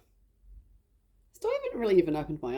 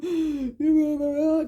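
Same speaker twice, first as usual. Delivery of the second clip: gasping sob; 10 semitones higher, 6 dB louder than usual. Retold or imitated. Retold crying breakdown.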